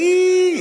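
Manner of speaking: long high eee on one note; mimicked translator squeal. Preacher reprising his translator joke, high held eee. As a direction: squeal a sustained eee as emphasis.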